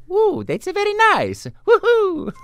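high gleeful cartoon whoop; bouncy excited plumber; Mario voice. Shown as a demonstration. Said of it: "I can say".

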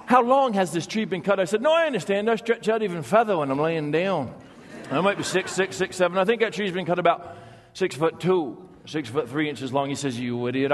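put-on storyteller Vermont accent, unhurried and dry; rural Vermont logger accent. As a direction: tell it like an old Vermont logger spinning a yarn. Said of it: "you've got the accent down".

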